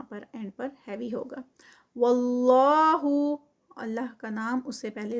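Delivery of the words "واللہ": slow, drawn-out tajweed recitation; Quranic recitation. Shown as a demonstration. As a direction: chant it slowly, stretching each sound. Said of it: "heavy ادا ہوگا". (آہستہ کھینچ کر تلاوت)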